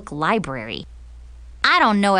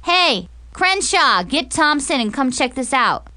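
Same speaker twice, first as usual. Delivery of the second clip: yelling across the room; 6 semitones higher than usual. Acted. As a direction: yell it to the far table. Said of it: "yelled to his lunch table".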